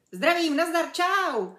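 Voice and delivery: loud, high, bouncy hello; exuberant greeting. A narrator's reading. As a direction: burst in with a cheery chimp greeting. Boisterous greeting from the chimp character.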